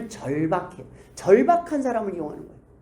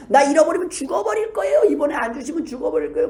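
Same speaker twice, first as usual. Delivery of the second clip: mock desperate threat; 6 semitones higher, 3 dB louder than usual. Mock threatening prayer to God.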